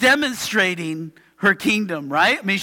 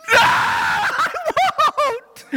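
put-on defiant child; much slower than usual. Daughter refusing again.

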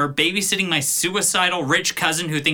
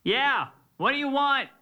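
distant, higher-pitched yell from upstairs; calling back; 8 semitones higher, 5 dB quieter than usual. Acted. Ryan answers from upstairs, calling back from afar. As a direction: shout back from another room.

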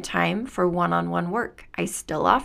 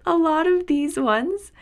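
laughing; 9 semitones higher, 3 dB louder, slower than usual. Real, not acted. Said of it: "laughs right before".

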